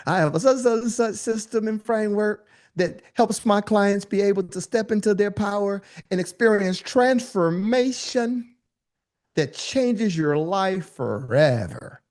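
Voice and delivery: high, slow, syrupy sales pitch; hammed-up coach selling her transformation; mocking pitch voice. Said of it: "I'm just being facetious".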